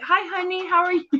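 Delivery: high, loud, gushing fake-friendly hello; mock sweet greeting. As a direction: put on an over-sweet phone greeting. Mimics two-faced friendly greeting.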